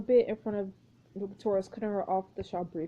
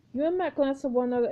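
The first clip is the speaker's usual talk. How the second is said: normal talking voice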